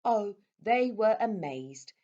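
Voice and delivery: usual speaking voice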